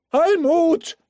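high, loud call across the crowd. Acted. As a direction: call her name from a distance. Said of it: "hörte sie Gerd rufen".

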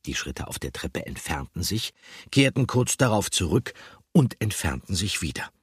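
plain reading voice

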